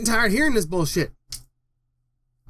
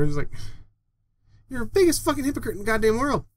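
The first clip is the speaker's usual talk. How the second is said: ordinary talk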